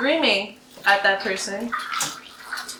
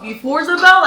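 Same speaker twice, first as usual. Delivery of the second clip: louder and higher, a retold teacher scolding; higher, louder snap of a mock yell; 6 semitones higher, 6 dB louder than usual. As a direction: play the teacher yelling, half-hearted. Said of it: "started screaming at us".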